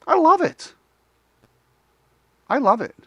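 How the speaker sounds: high delighted squeal of it; delighted I love it. Real, not acted. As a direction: gush over it. Delighted reaction.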